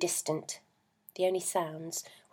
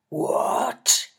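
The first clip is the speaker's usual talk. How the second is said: louder, furious roar; 5 semitones higher, 9 dB louder than usual. A narrator's reading. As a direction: roar it in sudden rage. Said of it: "he roared".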